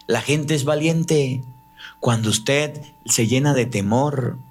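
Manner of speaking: usual speaking voice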